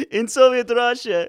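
broken English like a Soviet comrade; Russian accent. Pretend.

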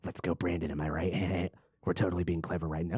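low, soft smug mock; smug snickering voice. Mocking a smug guy whispering to his buddy, low and smug. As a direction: snicker it like you're clever.

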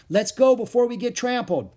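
high, loud, alarmed urging; urgent cry. A narrator's reading. Jack urging Annie away from the herd, urgent cry. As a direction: cry out to flee. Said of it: "he cried".

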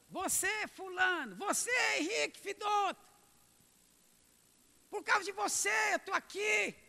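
high, loud accusing cry from afar; accusing shout. Acted. Damned soul yelling blame at the believer from hell, anguished shout. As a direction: hurl the blame across the gulf.

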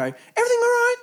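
very high, loud, chirpy check-in; intrusive over-nice waiter. Retold or imitated. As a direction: pop over and chirp it at the diners. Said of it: "at Grill, they do that".

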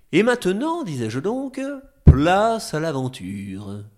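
loud, slow showman build-up; grand announcement. Acted.

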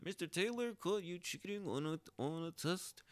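high, put-on voice of the school official; principal's voice. Mimicking the official who caught him cheating, in a high put-on voice. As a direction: imitate the stern principal reading the charge. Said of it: "And his voice".

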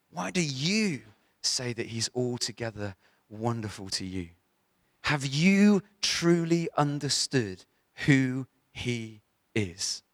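slow questions, "you" lifted and drawn out; pointed stress on YOU. Real, not acted. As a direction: lean on each "you", pause, then let the rest drop. Preacher challenges congregation with repeated emphasis.

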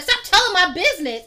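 high, loud retold order; hammed-up telling-off; mock scolding. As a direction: snap it at grandma. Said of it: "I was like".

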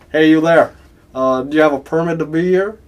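loud Southern landowner challenge; Kentucky accent. Acted. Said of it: "a guy in Kentucky".

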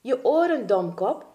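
high, loud cry dropping on the insult; urgent shout, falling away; calling out. Acted. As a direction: call out an impatient reminder. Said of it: "riep Langaneki".

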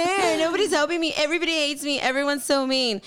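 high, whining self-pity; whiny voice. Retold or imitated. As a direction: whine like a sulky beginner. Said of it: "Like that whiny voice".

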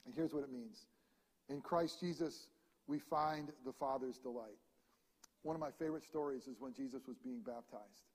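ordinary talk

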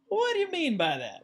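high-pitched, incredulous question; mock puzzled challenge. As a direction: act taken aback and ask for an explanation. Acts out questioning a pastor's remark.

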